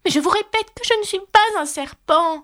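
high flustered Alice. Acted. Alice protests again, flustered.

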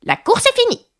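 high, sudden announcing cry; abrupt shout. A narrator's reading. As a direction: call out the finish abruptly. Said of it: "le dodo cria brusquement".

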